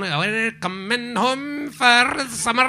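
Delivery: hammed-up Irish lilt, stretched out; Irish accent. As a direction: croon it like an Irish advert singer. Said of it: "That's an Irish accent".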